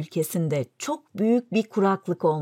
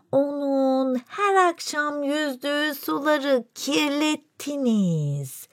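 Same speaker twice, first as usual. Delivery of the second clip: slow, eerie otherworldly voice; strange creature voice; 3 semitones higher, much slower than usual. Acted. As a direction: play an uncanny moon messenger, drawn out. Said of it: "bu garip konuşan yaratıktan".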